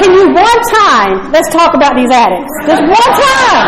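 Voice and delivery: hammed-up exasperated whine; exasperated plea. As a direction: plead in mock frustration, stretching one time. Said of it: "I'm like".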